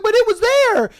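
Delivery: put-on high, plaintive girlfriend protest; mock protest. Girlfriend defending spending the rent money, high mock protest.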